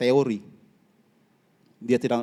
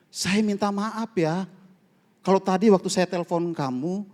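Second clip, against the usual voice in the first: pleading apology; 6 semitones higher than usual. Retold or imitated. Retold apology to the hurt friend.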